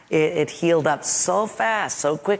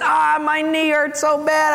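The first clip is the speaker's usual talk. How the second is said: pained whine; 8 semitones higher, slower than usual. Retold or imitated. Boy pleading to the nurse.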